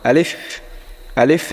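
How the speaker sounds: আলিফ with a long breathy f; airy alif. Shown as a demonstration. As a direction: say আলিফ, lips not closed, air escaping. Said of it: "মুখের ভিতর থেকে যেন বাতাস বের হয়". (আলিফ, ঠোঁট না মিলিয়ে বাতাস ছেড়ে)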